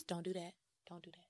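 barely audible, far-off "Don't do that"; a faint, distant "Don't do that" that is hard to hear. Shown as a demonstration. God's voice when you're far from Him, barely audible. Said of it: "when you're far from God and you can't hear his voice".

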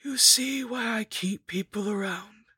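high-pitched undead schemer; lich villainess. A narrator's reading. Lich Agnes showing off to her captive, in a high villainess voice.

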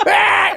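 high startled shriek of alarm; mock panicked shriek. Mom panicking in the car, high shriek. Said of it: "she'd be like".

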